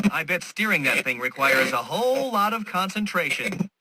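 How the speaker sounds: ordinary delivery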